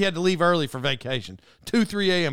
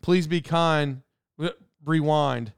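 put-on slow, deliberate, like an old video-store slogan; slow slogan recital; 3 semitones lower, slower than usual. Shown as a demonstration. Recalling the video-store slogan, slow and deliberate. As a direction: spell out the old tagline slowly, word by word. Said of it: "just that saying".

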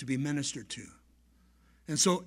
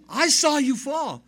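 loud, high cry of a shocked mother; alarmed exclamation; 8 semitones higher, 8 dB louder than usual. Retold or imitated. Mom who saw her boy fall, exclaiming. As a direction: burst out in disbelief. Frantic mother in retold story.

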